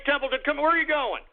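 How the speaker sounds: ordinary talk, nothing marked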